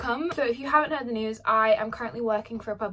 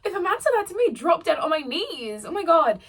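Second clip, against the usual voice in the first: high, excited swooning gush; giddy swooning; 6 semitones higher, 3 dB louder than usual. Real, not acted. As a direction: gush like a smitten fan. Swooning over fictional man.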